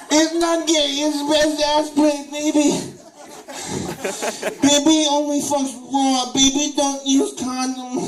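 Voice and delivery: put-on high, loud whiny baby-talk voice, slowed; hammed-up baby-voiced student begging; college student impression. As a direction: play a needy manchild in baby voice. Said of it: "my impression of a college student".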